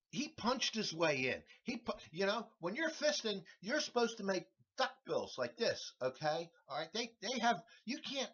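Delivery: ordinary talk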